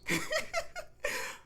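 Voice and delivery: very high, airless giggle; breathy high giggle. Acted. John giggles arriving near Rose. Husband's laugh entrance.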